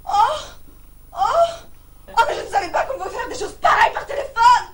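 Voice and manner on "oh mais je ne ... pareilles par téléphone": loud, high-pitched shocked protest; scandalised outburst. Acted. Woman shocked by an obscene call, scandalised outburst. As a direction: cry out in outrage, far louder.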